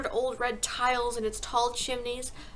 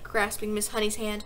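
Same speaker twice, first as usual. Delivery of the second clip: ordinary delivery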